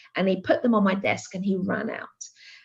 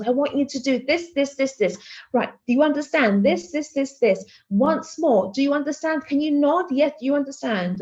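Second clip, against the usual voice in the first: frantic higher-pitched instructions, retold; mad, insistent woman; 6 semitones higher than usual. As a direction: press each demand urgently, pitched up. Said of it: "traumatized by this mad Greek woman".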